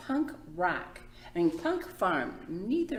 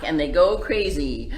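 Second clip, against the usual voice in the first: ordinary delivery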